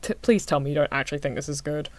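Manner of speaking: ordinary talk, nothing marked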